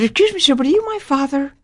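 put-on small, high-pitched child's question; timid. Girl approaching a man who may be her father, timid. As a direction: re-enact a nervous girl asking, pitched far up.

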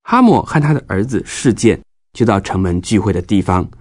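ordinary delivery, nothing marked

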